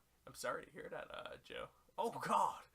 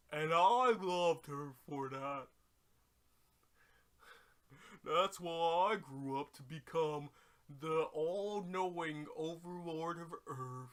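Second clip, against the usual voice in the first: slow, earnest Gump-style drawl; lightly voiced simple storyteller; Forrest Gump Dende; 3 dB louder than usual. Dende ending his Forrest Gump speech, slow and earnest.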